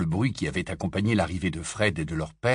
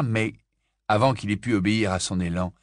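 usual speaking voice

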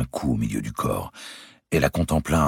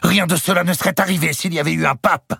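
high, loud burst; clenched outburst; 5 dB louder than usual. A narrator's reading. Louis lashes out about the pope, fists clenched. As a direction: explode on the word. King rants on.

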